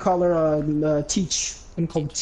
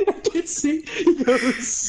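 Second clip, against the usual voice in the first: helpless laughter, pitch shooting up; laughing too hard to go on; cracking up; 9 semitones higher, much slower than usual. Real, not acted. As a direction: try to talk through a real laughing fit. Cracks up telling the story.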